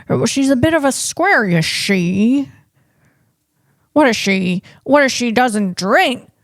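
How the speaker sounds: hammed-up thirties movie twang; a hint of an old-film star; terrible Jimmy Stewart. Showing viewers how forties actors talked, in a terrible Jimmy Stewart voice. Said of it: "a very terrible Jimmy Stewart".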